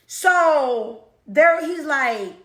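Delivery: ordinary delivery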